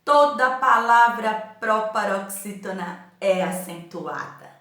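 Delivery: teacher spelling out the rule deliberately; rule stated slowly. Shown as a demonstration. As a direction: drill the rule into the pupils. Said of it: "ouviu a Pro falar".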